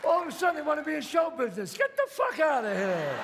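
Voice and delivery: loud, high, furious yell at the intruder; angry shout. Acted. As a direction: throw him out with a roar. Gangster throws out annoying gambler.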